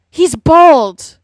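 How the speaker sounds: exasperated yell; indignant shout. Real, not acted. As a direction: shout it out in disbelief. Exclaims over the coworker's hypocrisy.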